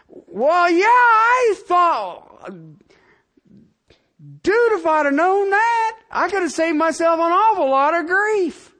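high, loud preacher's sarcasm; incredulous preacher ramping up; full-force preaching. Acted.

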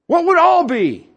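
high, loud, driving sermon voice; fervent preaching. Acted. Preacher to the congregation, raising the pitch and volume. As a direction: preach it out to the whole church.

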